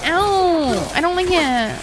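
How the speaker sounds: high, drawn-out complaint; mock pained whine. Retelling the painful dental mould to viewers, mock pained whine. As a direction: whine it like a hurt child. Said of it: "it hurts".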